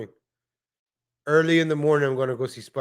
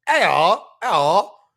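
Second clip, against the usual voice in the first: loud, higher British caricature; cartoon British greeting; mock British accent; 5 semitones higher, 6 dB louder, much slower than usual. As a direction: mimic British speech with a rising, hammed-up lilt. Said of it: "like, British".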